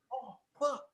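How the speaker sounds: high but softly retold excitement; losing his lid. Friend on FaceTime spotting them together, retold excitement. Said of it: "loses his lid".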